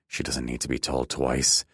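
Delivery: usual speaking voice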